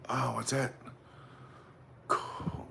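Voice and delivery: normal talking voice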